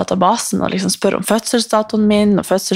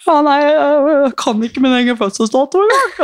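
mock-embarrassed self; 7 semitones higher than usual. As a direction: play your own flustered phone call. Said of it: "ringer jeg henne ... og bare sånn". (flau, lys tilståelse i telefonen)

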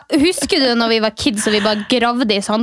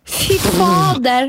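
slow drawn-out fy fader; 5 semitones higher, much slower than usual. Real, not acted. Drawn-out reaction.

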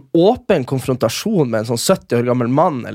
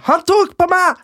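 mock tattling woman; slower than usual. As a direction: squeal it like a queue-jumper playing victim. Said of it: "og så sier du sånn". (lys, høy og sutrete spøkeanklage)

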